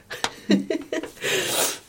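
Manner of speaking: giggling while sniffling; high unvoiced giggle through a sniff; sniffly giggle. Real, not acted. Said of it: "ich muss schniefen und lachen".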